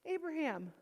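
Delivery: high, rising-loud alarmed question; frightened call. Retold or imitated. Mother confronting her son with the knife, high and alarmed. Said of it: "And I said".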